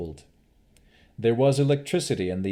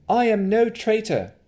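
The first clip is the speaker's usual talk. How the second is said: defiant woman; 13 semitones higher, 7 dB louder than usual. Acted. Navara defies the Baron.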